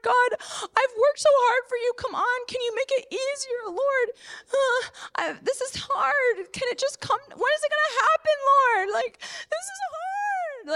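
high, tearful whining to God, breaking into cries; a high crying lament that climbs and then collapses; whiny soul complaint. Retold or imitated. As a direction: plead like a worn-out child begging for it to be easier. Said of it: "if I were to go with my soul, it would be like".

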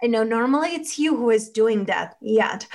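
usual speaking voice

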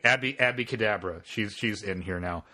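ordinary talk

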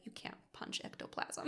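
hushed, whispery dry delivery; disappointed, embarrassed. Retold or imitated. Amos embarrassed for Kylie, hushed and disappointed. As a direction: breathe it out, embarrassed on someone's behalf. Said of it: "so disappointed and embarrassed for her".

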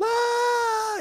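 sudden high mock scream of a hurt kid; loud imitated child's pained yell. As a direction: shriek like a child in pain. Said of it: "screaming in pain".

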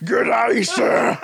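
laughing, shouted Australian Hulk voice, pitched high; a goofy Aussie-accent Hulk, yelling through giggles. Pretend. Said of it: "What would a Hulk with an Australian accent sound like".

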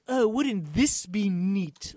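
mocking imitation. Mocking the director's self-indulgence.

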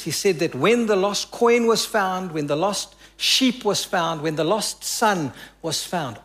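ordinary talk, nothing marked

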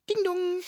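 high sing-song chime; playful mock doorbell; doorbell ding dong. Imitating a doorbell at police.

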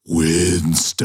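low drawn-out ghostly call; spooky echo voice. Retold or imitated. Ghost voice answering Winston in the station, low and drawn out. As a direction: call the name like a haunting echo. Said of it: "you hear".

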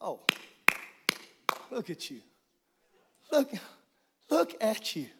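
hushed, proud and tender; a father admiring his child, hushed; tender God voice. Retold or imitated. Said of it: "he says".

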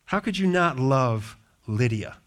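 ordinary talk

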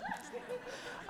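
a quick airy laugh; a light unvoiced laugh at her own joke; breathy laugh. Real, not acted. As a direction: let a short laugh slip out. Laugh after joking about Makobi.

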